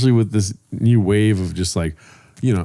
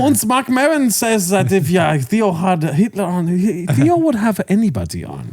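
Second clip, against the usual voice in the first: mock German accent, pitched higher; Joe Rogan in a German accent; 6 semitones higher than usual. Imitating a podcaster for his cohost, in a mock German accent. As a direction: ham up a German accent for laughs. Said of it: "I just picture Joe Rogan in a German accent".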